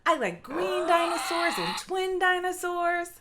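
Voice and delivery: plain reading voice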